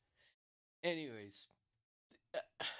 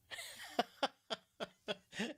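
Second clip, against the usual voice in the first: high run of real laughter; amused laugh; 12 semitones higher, 4 dB louder than usual. Real, not acted. Host mocking a reporter's Kentucky title, laughing. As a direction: laugh out loud at the absurdity.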